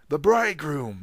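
high, loud announcing bawl. Acted. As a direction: call it out across the courtyard. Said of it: "bawling loudly".

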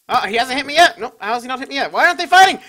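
rising panicked shouting; being chased. Real, not acted. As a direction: yell in growing panic. Being chased, under fire.

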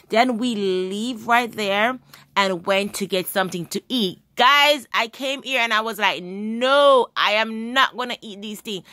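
ordinary talk

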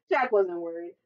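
usual speaking voice

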